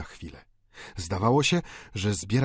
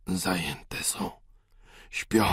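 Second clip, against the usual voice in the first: whisper; much slower than usual. Acted. Dying father whispers about his daughters, bitter. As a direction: breathe it out bitterly.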